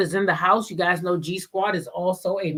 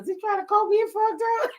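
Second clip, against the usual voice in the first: much higher, fast incredulous squeak; high fast mock outrage; high mock disbelief; 14 semitones higher, faster than usual. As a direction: act out the shocked confusion. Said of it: "I was like".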